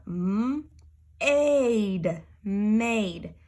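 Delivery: slow m, aid, then blend into made; sounding out made. Shown as a demonstration. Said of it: "coming over here are my examples".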